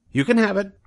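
proud goose soaking up congratulations; fussy goose. Acted. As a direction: bob and bow through every thank you. Goose replies.

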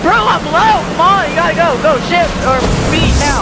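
high, frantic yelling; panicking gamer. Real, not acted. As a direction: panic as the car stalls. Panicking at teammate driving.

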